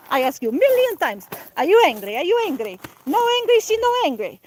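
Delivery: mock grandmother tantrum, rising to a squeak; Italian fit. Grandmother repeating her scolding in a high Italian fit. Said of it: "a little Italian fit".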